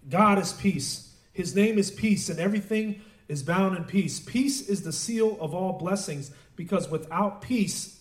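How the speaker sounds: slow, emphatic preacher's delivery; declaimed quotation. Retold or imitated. Preacher quoting a rabbi on peace, emphatic declaimed quotation.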